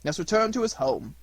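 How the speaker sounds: loud, high start easing down; old man firmly correcting; old man reassuring. A narrator's reading. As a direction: burst out with the good news, then settle.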